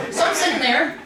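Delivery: normal talking voice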